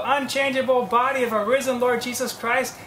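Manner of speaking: ordinary delivery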